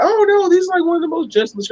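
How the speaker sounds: squeaky, soothing tour guide; mock high reassuring guide. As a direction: guide calming the nervous dad, mimicked way up high. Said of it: "they was like".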